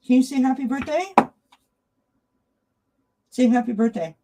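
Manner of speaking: high, loud sing-song pet talk. Real, not acted. Owner asking cat to greet Sharon. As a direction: ask the cat in a high cutesy voice.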